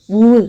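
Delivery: "w" as the rounded glide that starts water; "w" as a short rounded glide; isolated w sound. Shown as a demonstration. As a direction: round the lips for "w" alone. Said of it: "Example, water".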